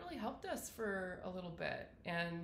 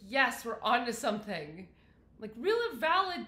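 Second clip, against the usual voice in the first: imitating her own burst of excited hope, higher and louder; retold excitement; 4 semitones higher, 9 dB louder than usual. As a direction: recall the thrill of finally getting somewhere. Retelling a moment of hope.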